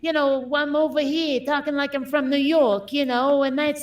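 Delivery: put-on New Yorker accent, casual. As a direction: talk like you're from New York. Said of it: "Do the New York one".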